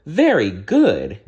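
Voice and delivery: fairy praising the princess's right answer, delighted; exclaiming. Acted. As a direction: cheer her on warmly. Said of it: "exclaimed Fauna".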